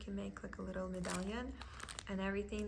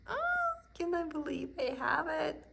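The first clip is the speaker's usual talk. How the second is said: higher and louder, replaying her own thrilled gasp of disbelief; excited; 8 semitones higher, 6 dB louder than usual. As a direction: burst out in delighted disbelief. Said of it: "I'm like".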